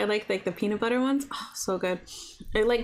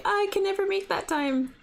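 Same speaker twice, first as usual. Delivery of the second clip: whiny viewer; 9 semitones higher than usual. Retold or imitated. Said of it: "I got complaints".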